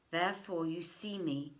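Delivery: ordinary delivery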